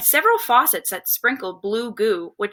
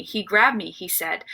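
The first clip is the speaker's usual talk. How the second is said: ordinary delivery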